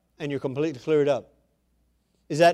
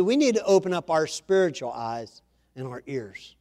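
usual speaking voice

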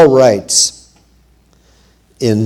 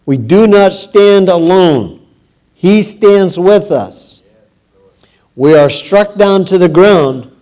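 louder and higher, a fervent proclamation; preaching at full force; 6 semitones higher, 7 dB louder, slower than usual. Acted. Preacher encouraging the congregation not to despair, fervent. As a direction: lift the congregation with a rallying cry.

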